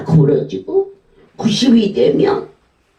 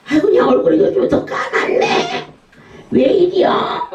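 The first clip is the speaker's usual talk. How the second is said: much higher, mock concerned prying; nosy busybody; 11 semitones higher than usual. Asking a woman about her face, high mock concern. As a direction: ask like a prying neighbor. Mimics tactless remark.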